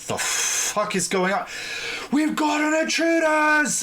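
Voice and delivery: high, drawn-out alarmed shout; shouting guard. Acted. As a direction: raise the alarm to someone down the street. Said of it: "And they're shouting at the other end".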